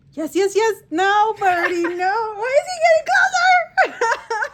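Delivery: high, loud laughing panic; panicked squeal. Real, not acted. Bird moves back toward her.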